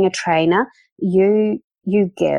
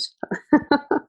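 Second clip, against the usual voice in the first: light high laugh; 10 semitones higher than usual. Real, not acted. Guest laughs after "I'm excited.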